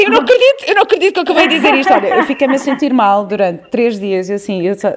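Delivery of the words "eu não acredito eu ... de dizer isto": higher and louder, shocked disbelief; shocked at herself. Retold or imitated. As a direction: gasp at your own words in horror. (mãe chocada consigo própria, mais aguda e mais alta)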